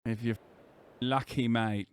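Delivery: put-on grumbling British bloke, English accent. Streamer reading the ad break to viewers, put-on English accent. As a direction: do a low-key cheeky Brit accent.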